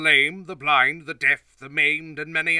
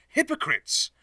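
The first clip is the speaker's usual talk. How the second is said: a sharp high rebuke, pitched up; rebuking cry. Acted. Jesus denouncing the Pharisees with a high rebuking cry. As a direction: cry out the word in reproach.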